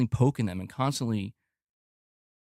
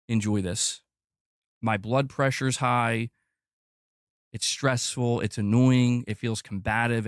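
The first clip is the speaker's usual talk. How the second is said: ordinary talk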